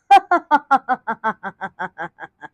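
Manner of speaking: long, wicked cackle trailing downward; sly laugh. A narrator's reading. Schemer waiting to watch the outing unfold, sly laugh. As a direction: laugh like a schemer, drawn out and falling. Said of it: "وضحكت بخبث".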